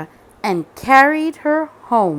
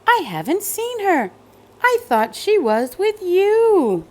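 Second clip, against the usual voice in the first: worried mother; 9 semitones higher than usual. Acted. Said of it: "said her mother".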